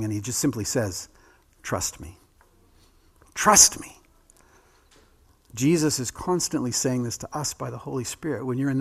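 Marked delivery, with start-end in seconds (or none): 1.6-4.0 "Trust me" said as a firm command, no question in it. Shown as a demonstration. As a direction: tell them, don't ask, like a king. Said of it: "He gives it as a command".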